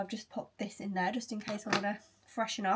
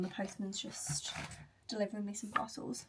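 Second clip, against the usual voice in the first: hushed aside; 7 dB quieter than usual. Real, not acted. Interrupted by the postman.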